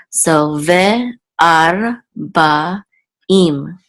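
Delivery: ve ar ba im slowly, syllable by syllable; sounding it out. Shown as a demonstration. Teacher sounding out the word for students. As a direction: spell out ve ar ba im piece by piece. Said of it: "We'll say im".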